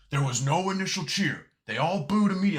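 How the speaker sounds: sneering imitation of a bitter analyst; mocking Bubba Ray. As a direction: parody him as a sore old complainer. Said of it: "fucking Bubba Ray saying".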